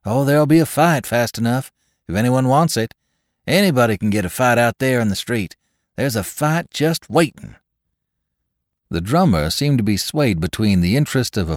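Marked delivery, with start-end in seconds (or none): 0.0-7.5 higher, louder, eager warning; young man's warning. Acted. Young man tells the drummer, higher and eager. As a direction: relish the promise of a fight.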